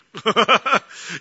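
a real, short burst of laughter; amused laugh. Real, not acted. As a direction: laugh at your own joke. Preacher laughs at own line.

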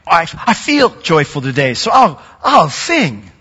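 mock cheery only-when-I-feel-it; mock cheerful. Pastor mocking fair-weather worship, light mock cheer. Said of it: "because I feel like it".

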